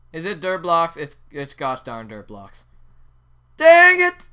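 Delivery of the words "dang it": high loud frustrated yell; frustrated shout. Real, not acted. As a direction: blurt it out in frustration. Missed pumpkins, frustrated outburst.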